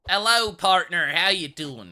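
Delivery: lightly hammed-up cowboy; cowboy greeting. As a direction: answer the stranger like a hearty cowboy. Echoing NPC greeting loudly.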